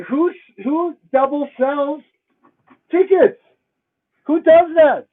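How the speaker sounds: exasperated rising yell; outraged disbelief. Real, not acted. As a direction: demand an answer from the theater. Outraged at theater double-selling.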